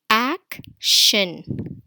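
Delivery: "Action" as ac, long pause, shin; two separate beats on "Action"; split into syllables. Shown as a demonstration. Teacher introducing a two-syllable word, split into syllables. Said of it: "this word has two syllable".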